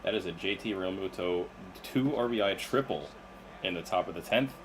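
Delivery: excited sports announcer call; play-by-play call. Acted. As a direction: call it like a live broadcast. Reads a game call.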